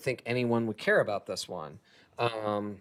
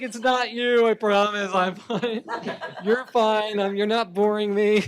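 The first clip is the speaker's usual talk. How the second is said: laughing apology; 6 semitones higher, 3 dB louder than usual. Retold or imitated. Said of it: "I'm like".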